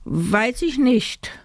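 high, slow, lilting answer; coy doll voice. A narrator's reading. Doll Amanda answers the teacher, high coy lilt. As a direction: draw it out airily, pitched high. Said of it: "flutzerte Amanda".

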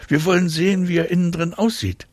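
put-on high-pitched little girl voice; child explaining. A narrator's reading.

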